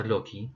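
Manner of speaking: loci said LOH-kee; "loci" as LOH-kee, rhyming with key; hard-c, ee ending. Shown as a demonstration. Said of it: "can be pronounced as".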